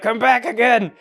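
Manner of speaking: high, loud goofy villain cry; hammed-up villain. Buddy returns yet again, hammed-up loud and high. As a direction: crow it like an unkillable villain.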